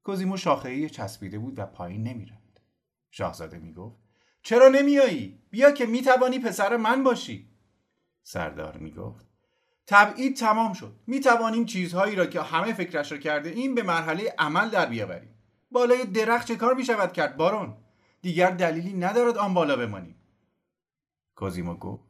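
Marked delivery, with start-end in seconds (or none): 4.4-7.5 very high, loud plea; calling up. Acted. Prince calls to Cosimo. 9.9-20.2 high, loud urging; urging call. Acted. General urges Cosimo down.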